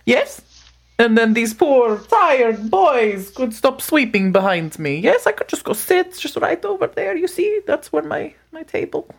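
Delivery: butter up the worker, gently; hungry creature coaxing the worker to bring food; Frosty flattering. Acted.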